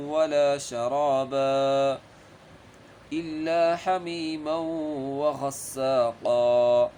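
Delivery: slow, melodic tajweed chanting with long vowels; Quran recitation. Shown as a demonstration. Teacher demonstrating the verses to the class, slow chant. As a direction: draw out every madd slowly.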